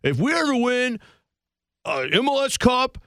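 high, hammed-up bar boast; mock the younger self making a big vow; boastful drunk promise. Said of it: "I told people at a bar once".

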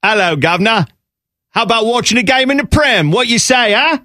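high-pitched mock Cockney; hammed-up chimney sweep English accent; fake English accent. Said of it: "fake accent".

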